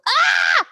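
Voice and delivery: high, loud startled yelp; sudden high, loud scream; panicked scream. Shown as a demonstration. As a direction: shriek like a brain in panic. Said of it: "your brain should go".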